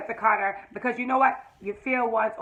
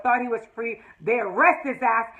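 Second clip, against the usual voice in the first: usual speaking voice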